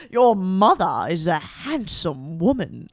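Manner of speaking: low, slow, drawn-out villain; Preminger. Acted.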